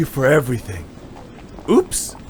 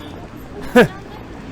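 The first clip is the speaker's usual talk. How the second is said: breathy laugh; 8 semitones higher than usual. Acted. Hostess tout greets Yuto.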